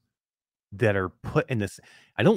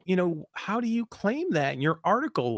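normal talking voice

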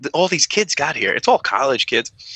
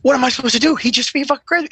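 exasperation; 12 semitones higher, faster than usual. Acted. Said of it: "mock exasperation".

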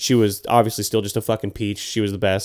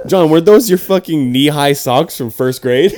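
loud, slow, higher-pitched taunt; mock roast; 5 semitones higher, 7 dB louder, slower than usual. Friend re-enacting the roast of John's socks, loud taunting. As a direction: drag out the jeer for the crowd. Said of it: "Us roasting John's fucking baby socks".

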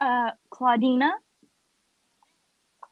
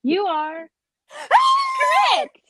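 squealing with delight; excited shout; 5 dB louder, much slower than usual. Real, not acted. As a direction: shriek it out, thrilled. Announces friend's right answer.

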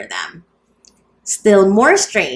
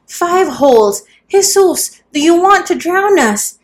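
much higher, loud shocked outcry; horrified exclaim; 10 semitones higher, 5 dB louder than usual. A narrator's reading. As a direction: shriek it out in horror, high and loud. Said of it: "exclaimed the horrified women".

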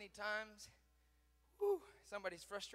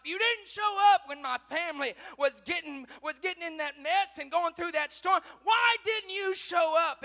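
high, loud protest building to a shout; loud reproach; 9 semitones higher, 16 dB louder than usual. Acted. Believer to God about family's storm, loud reproach. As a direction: cry out the accusation.

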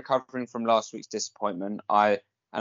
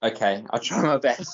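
ordinary delivery

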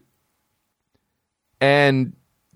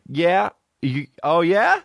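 sarcastic; 8 semitones higher, slower than usual. Acted. Sarcastic mock reply.

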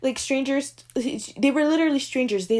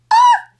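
high, loud squeal of delight; delighted scream; 13 dB louder than usual. Real, not acted. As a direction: shriek with fangirl joy. Overwhelmed by love for slow burns.

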